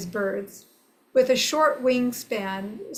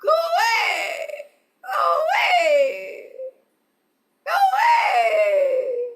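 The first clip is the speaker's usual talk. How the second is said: high, loud squawking bird cries; go-away bird call; 5 dB louder than usual. Shown as a demonstration. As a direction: shout it like a grumpy bird. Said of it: "say what seems".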